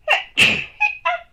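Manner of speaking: second loud sneeze; sneezing. Real, not acted. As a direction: sneeze out sharply. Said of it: "It's probably a second one".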